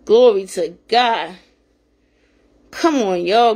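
normal talking voice

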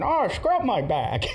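put-on high, slow, dreamy old man; dopey grandfather voice. Prank call grandfather in the tub, slow high dreamy. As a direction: drawl it out like a blissful old man.

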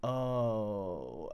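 low, soft deflated oh; a let-down little oh; disappointed oh. Retold or imitated. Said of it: "he was a bit like".

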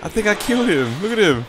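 high, loud alarmed telling; stunned excitement. Real, not acted. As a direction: tell it urgently, amazed. Repeats news to friend.

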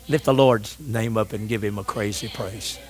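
low, soft aside after the climax; subdued call to praise; hushed exhortation. Real, not acted. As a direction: drop right down, low and soft.

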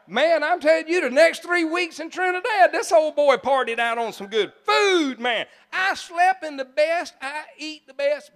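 loud, high preacher's exclamation; exuberant raving. Real, not acted. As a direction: rave about it at full voice. Raving about three weeks of feasting.

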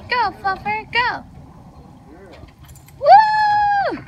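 high, loud cheer for the dog; excited cheering. Real, not acted.

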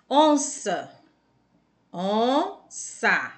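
onça stretched on the first syllable, then split in two. Shown as a demonstration. Teacher helping pupils find the stress in onça, syllable by syllable. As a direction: sound out onça with a long, strong on. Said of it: "pronunciou com mais intensidade".

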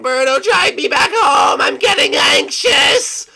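high, loud anxious cry; panicked shouting. Acted. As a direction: panic and beg to go home.

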